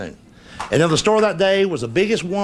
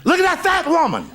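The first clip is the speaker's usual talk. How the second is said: put-on child blurting out; 10 semitones higher than usual. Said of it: "he said".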